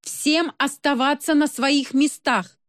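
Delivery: slow booming megaphone voice; booming metallic robot. A narrator's reading. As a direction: announce it through a loudspeaker. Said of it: "взревел металлический голос".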